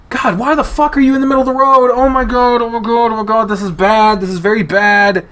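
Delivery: high, loud, fast panic; panicking. Acted. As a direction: freak out in rising panic. Panicking in the road.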